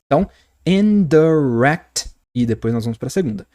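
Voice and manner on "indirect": "indirect" slowly, syllable by syllable; slow teacher's model of "indirect"; stress on last syllable. Shown as a demonstration. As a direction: land firmly on the "rect" of "indirect". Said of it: "é a minha sílaba forte".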